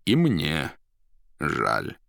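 measured agreement dropping low; confirming stranger. A narrator's reading. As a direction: agree with a pause, sinking on the last word. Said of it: "подтвердил неизвестный". (веско, с падением голоса)

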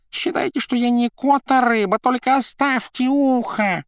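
very high, loud squeaking cat; rubbery high squeaky toy. Acted. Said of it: "вспискнул и захрипел". (пищит высоко и жалобно, как игрушка)

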